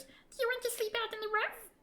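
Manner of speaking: high squeaky mocking question; teasing sprite. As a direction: needle them like a cheeky fairy. Creature teases traveller.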